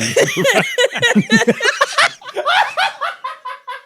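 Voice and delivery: loud, high cackling fit; explosive laughter. Real, not acted. Podcasters cracking up at the host parody, explosive laughter. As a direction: burst out in long, uncontrolled high laughter. Bursts out at own impression.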